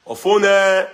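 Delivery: loud high call of the name Ofune. Real, not acted. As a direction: call Ofune out across the room. Calling out to a viewer.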